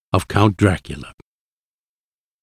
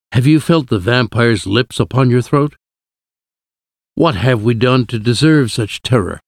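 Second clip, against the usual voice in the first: plain reading voice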